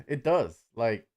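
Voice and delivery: normal talking voice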